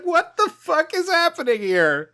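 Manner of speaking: very high, laughing disbelief; baffled outburst. Real, not acted. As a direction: squeal it in amused bewilderment. Baffled outburst at the film scene.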